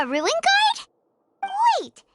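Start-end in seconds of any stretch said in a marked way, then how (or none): 1.4-2.0 a sharp, much higher "Wait!"; sudden surprise. Acted. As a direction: stop everyone, you've just spotted something. Spots something in the ruins.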